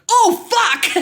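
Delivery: loud, high yelp of panic; reenacted alarm. Retelling the moment before falling, mock-panicked exclamation. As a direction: blurt it as the skates slip.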